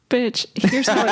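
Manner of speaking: half-hearted sassy diss; weak mock sass. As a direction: toss it off with weak attitude. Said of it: "that was the weakest".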